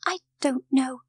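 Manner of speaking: put-on frightened little girl's hushed reply; whispered. A narrator's reading. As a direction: answer timidly in a breathy high voice. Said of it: "she whispered".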